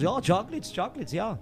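put-on light comic German accent; mock German. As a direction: imitate a keen German, hammed-up accent. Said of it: "I mean, the Germans".

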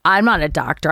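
usual speaking voice

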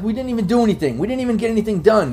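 high-pitched, insistent captive; protesting prisoner. Acted. Game master as intimidated prisoner excusing himself, protesting. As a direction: protest your innocence to your captors. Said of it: "he seems a bit intimidated by that".

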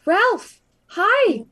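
loud, high call to the guard. Acted. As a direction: get his attention over the commotion. Character calls to armed guard.

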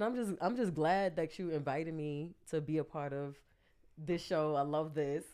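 normal talking voice

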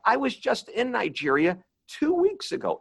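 high-pitched, jokey enthusiasm; enthusiastic. Retold or imitated. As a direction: play the excited fellow traveller. Retold joke to audience member.

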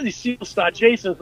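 usual speaking voice

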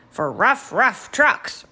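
loud truck voice, punching each word; lightly voiced emphatic digger; rattling digger. A narrator's reading. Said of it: "rattled Digger".

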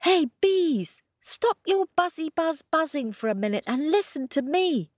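high, quick call; Kojo calling bees. A narrator's reading. Kojo calling out to the busy bees. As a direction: hail the bees cheekily. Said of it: "said Kojo".

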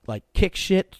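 ordinary talk

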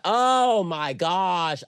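high, loud gushing; excited. Real, not acted. Gushing to a friend about Paris nights, excited. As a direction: swoon over the memory.

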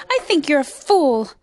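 high, loud mocking jeer; amused, loud contempt; laughing scorn. A narrator's reading. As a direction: jeer it as the sour kangaroo. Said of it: "laughed the sour kangaroo".

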